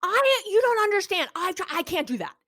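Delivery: high, mock upset protest; escalating partner. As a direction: act out the partner boiling over. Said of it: "They escalate, They go".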